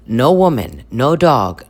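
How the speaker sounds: ordinary delivery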